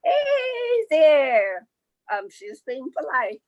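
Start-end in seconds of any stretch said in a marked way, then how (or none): 0.0-1.7 put-on high, louder girlish hey there; high child's greeting; high bright greeting. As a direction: call out sweetly to the creature. Girl greets strange creature.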